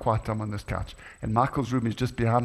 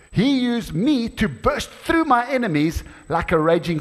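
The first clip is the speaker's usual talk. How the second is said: excited reading; 9 semitones higher, 5 dB louder than usual. Acted. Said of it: "Next, next line".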